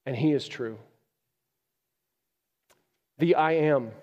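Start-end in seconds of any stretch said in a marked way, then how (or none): 3.2-3.9 louder, higher, building proclamation; preaching climax. Real, not acted. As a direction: build it up like a rising praise. Preaching climax on God's names.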